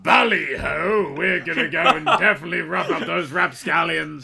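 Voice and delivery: a plummy aristocrat rallying the hunt; posh toff. Retold or imitated. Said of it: "You're like".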